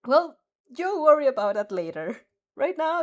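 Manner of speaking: plain reading voice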